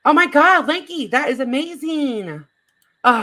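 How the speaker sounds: thrilled shout at the good news; excited. Real, not acted. Streamer reacts to good news.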